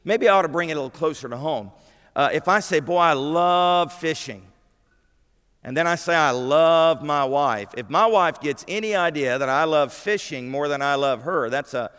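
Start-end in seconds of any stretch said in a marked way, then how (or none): 2.8-4.5 LOVE drawn out long and emphatic; slow, gushing stress on love; gushing enthusiasm. Acted. Voicing enthusiasm for a hobby. 6.2-7.7 love drawn out long and emphatic; slow, emphatic declaration stretching love; gushing devotion. Acted. Same gushing phrase, about his wife.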